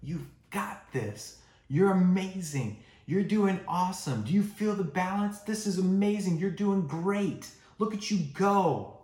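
high, loud cheering for a wobbly rider; cheering on a kid. Retold or imitated. Parent cheering the kid learning to ride, high excited praise. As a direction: gush with proud encouragement. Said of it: "you're saying things like".

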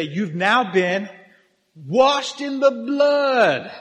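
loud, slow, high declaration; ritual proclamation. Acted. Priestesses to a new initiate under the platform, proclaimed loudly. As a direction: stretch it out and build to a ringing shout.

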